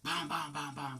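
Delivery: voiced, ringing knock sounds; rhythmic spoken knocking, fully voiced; imitated knocking. Imitates knocking at the door again.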